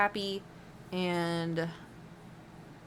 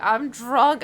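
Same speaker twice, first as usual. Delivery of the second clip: put-on drunk whine; 5 semitones higher, 10 dB louder than usual. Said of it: "And he'd be like".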